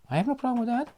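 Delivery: high, prissy-sounding mock reply; mock high take. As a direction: squeak it up high, mocking. Repeats line mocking.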